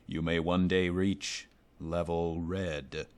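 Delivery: slow, solemn trailer narrator; deadpan ad line. Retold or imitated. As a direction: lay each word down slow and grave. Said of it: "the delivery of it was just".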